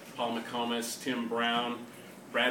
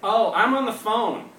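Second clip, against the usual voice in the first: higher, louder, slow cheerful reply; smiling neighbor; 10 semitones higher, 10 dB louder than usual. Retold or imitated. Neighbor explains the phone call, smiling and higher. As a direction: sound amused, explaining. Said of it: "said the smiling head".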